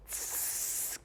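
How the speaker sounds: unvoiced hissing S; isolated S hiss. Shown as a demonstration. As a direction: hold a soft S hiss. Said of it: "try that".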